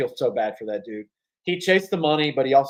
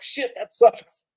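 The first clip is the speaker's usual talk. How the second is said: put-on high, slow, strained kid voice; pained kid; 10 semitones higher, much slower than usual. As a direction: whine it out like a stung child. Kid getting whipped with switch.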